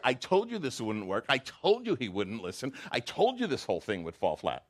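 mock upset complaint. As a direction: act out a fed-up prophet. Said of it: "I'm over dramatizing it".

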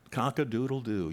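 mock rooster crow; preacher imitating the rooster, light mock crow. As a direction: crow like the cock in the story. Rooster crows after denial.

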